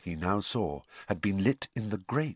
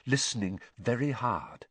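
plain reading voice

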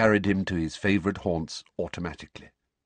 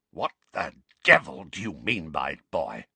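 croaky furious voice; 3 semitones lower than usual. A narrator's reading. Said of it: "in a croaky voice that trembled with fury".

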